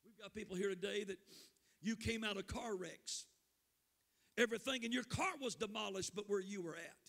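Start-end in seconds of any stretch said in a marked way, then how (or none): none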